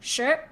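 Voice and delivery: "Sure" gliding upward like a question; second tone. Shown as a demonstration. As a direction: model "Sure" with a rising tone, R barely reached. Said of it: "with the second tone".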